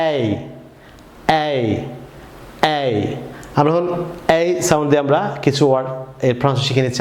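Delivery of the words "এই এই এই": এই said three times, slow and clear; AY sound three times. Shown as a demonstration. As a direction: drill এই three times, spaced apart. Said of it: "বলুন আমার সঙ্গে তিনবার বলুন".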